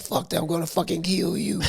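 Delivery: high, held-back angry voice; mock death threat. Imitating enraged roommate's threat.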